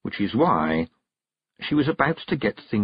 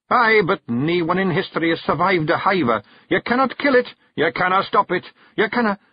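much higher, louder urgent Scottish; alarmed Feegle warning; 10 semitones higher, 3 dB louder than usual. Acted. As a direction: press the danger. Rob warns of hiver.

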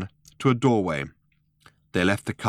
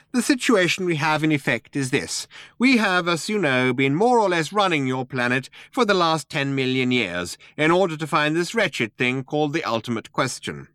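high little mouse voice; businesslike mouse; 7 semitones higher than usual. A narrator's reading.

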